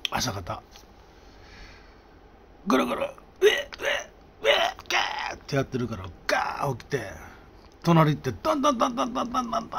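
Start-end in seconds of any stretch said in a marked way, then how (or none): 2.7-5.4 much higher gurgle then gasping gags; hammed-up retching noises; imitated gargling retch. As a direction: mimic a noisy retching neighbor.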